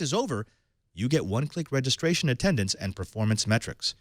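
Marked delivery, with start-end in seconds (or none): none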